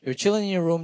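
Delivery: ordinary talk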